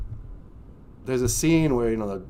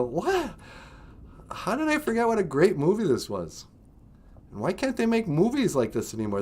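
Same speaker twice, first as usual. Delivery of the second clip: excited disbelief; 8 semitones higher than usual. Retold or imitated. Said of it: "and you go".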